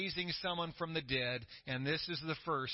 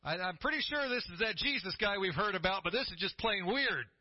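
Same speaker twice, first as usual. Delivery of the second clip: full-force preaching; 8 semitones higher than usual. Acted. Pastor preaching the raising of the widow's son, fervent. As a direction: lift the church with building excitement.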